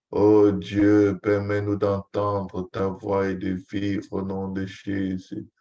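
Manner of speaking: ordinary delivery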